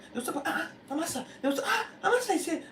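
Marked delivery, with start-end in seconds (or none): 0.4-1.3 shocked gasp. Retold or imitated. Warriors stop at the body. 1.6-2.3 high, loud dismayed cry of the name; shocked cry. Retold or imitated. Warriors finding Amasa dying, crying out in shock.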